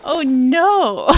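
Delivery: hammed-up, loud oh no in a borrowed voice; Lucy Darling oh no. Gamer startled by her chair, mock posh oh no. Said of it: "like Lucy Darling".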